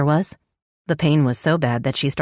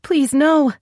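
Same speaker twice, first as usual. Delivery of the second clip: desperate pleading; 12 semitones higher, 4 dB louder than usual. Acted. Labouring woman begs in pain.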